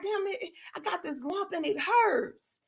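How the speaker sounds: hushed retold complaint about a sore lump; worried mutter. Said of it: "and I was like".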